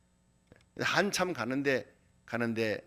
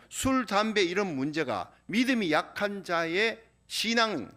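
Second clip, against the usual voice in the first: higher, louder sermon delivery building in fervour; fervent preaching; 4 semitones higher, 3 dB louder than usual. Acted. Pastor urges the congregation not to judge, fervent preaching. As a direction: drive the point home to the congregation.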